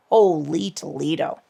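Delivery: ordinary talk, nothing marked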